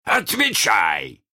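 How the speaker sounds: high, drawn-out command; shouted order. A narrator's reading. Hook orders the voice to answer, shouted. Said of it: "закричал он".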